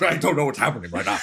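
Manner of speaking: high, rushed, flustered man breaking into laughter; [name]. Acted. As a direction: panicky babble, played for laughs. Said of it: "I loved your stupid voice".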